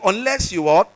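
prompting congregation. Real, not acted. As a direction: push the crowd to respond. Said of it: "I didn't hear you".

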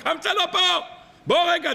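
high, urgent call out; alarmed call. Acted. Wedding host calling out, alarmed his friend is missing.

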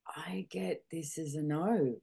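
slow whispered realisation; slow hushed inner voice, almost to herself. Retold or imitated. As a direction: breathe it out slowly, barely voiced. Her inner knowing on waking.

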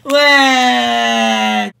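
sudden loud startled what; surprised shout. Acted. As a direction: blurt it out in shock.

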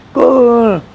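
a sharp, high "Go!" shooing a beggar away; mock dismissive shout. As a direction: toss it off like chasing someone off. Quoting what others never say.

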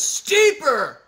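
shouting at the hill; imitated yell. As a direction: scream it up the hill. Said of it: "and he yells".

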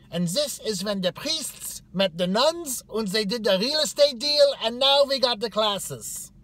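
high, loud barked history; caricatured martinet rattling off facts at a shout; succinct, strict lecturer. Retold or imitated. Orientation leader summing up university history, loud and succinct. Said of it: "was very succinct. She's like".

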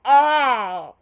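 high, loud anguished cries; Tommy freaking out. Shown as a demonstration. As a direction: cry out like a man losing it. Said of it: "Tommy freaking out".

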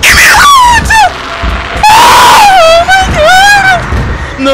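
very high, loud shrieks; drawn-out shrieks of terror; terrified screaming. Real, not acted. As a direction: scream in blind panic. Monster moves, player screams.

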